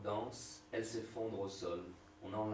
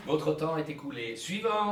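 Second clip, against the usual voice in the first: loud; 5 semitones higher, 9 dB louder than usual. Acted. Bureaucrat dismissing at counter.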